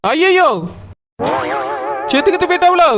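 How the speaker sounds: alarmed cry. Acted. Doctor hints the patient died.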